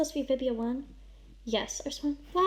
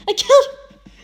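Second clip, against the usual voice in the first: high, loud anguished scream; screamed confession; 12 semitones higher, 17 dB louder than usual. A narrator's reading. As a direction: scream it out in horror.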